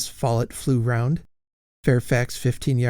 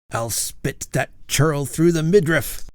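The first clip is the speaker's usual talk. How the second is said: growling threat; 4 semitones higher than usual. Acted. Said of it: "growled Sir Hugo".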